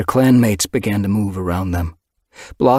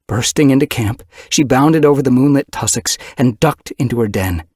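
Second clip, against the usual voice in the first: louder, driving chase narration; breathless urgency; 5 semitones higher, 5 dB louder than usual. A narrator's reading. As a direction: push the pace of the chase. Frantic run home narrated.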